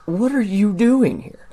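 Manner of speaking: high, slow disbelief; can't believe you're here; incredulous. Acted. Guard incredulous.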